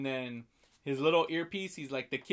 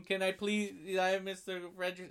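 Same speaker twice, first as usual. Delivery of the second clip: tag; 5 semitones higher than usual. Retold or imitated. Voicing Russell's plea to Carl.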